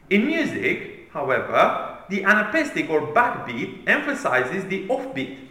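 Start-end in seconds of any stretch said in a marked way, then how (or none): none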